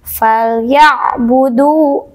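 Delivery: "Falyabudu" with the ain pressed firmly; slow, press the ain in "Falyabudu"; ain sukun pressed. Shown as a demonstration. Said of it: "harus ditekan membacanya ya".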